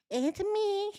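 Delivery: high, eerie mock doll voice; lightly mocking creepy doll. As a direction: echo the doll, squeaky and thin.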